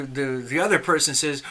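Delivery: ordinary delivery, nothing marked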